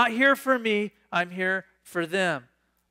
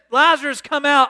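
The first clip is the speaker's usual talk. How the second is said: high, loud call to the grave; loud cry; 7 semitones higher, 6 dB louder than usual. Acted. Jesus before the staring crowd, calling out loudly. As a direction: call him out with full voice. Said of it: "and he says".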